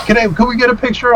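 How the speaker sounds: high-pitched, put-on fan request; imitated eager fan. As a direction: ask like a starstruck admirer. Fan asks for photo, retold.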